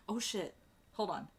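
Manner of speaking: ordinary delivery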